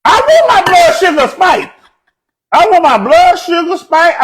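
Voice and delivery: high, loud insistence; loud mock protest. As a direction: protest loudly as if proudly defiant. Mock protest about his diet.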